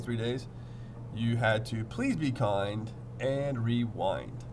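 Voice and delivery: usual speaking voice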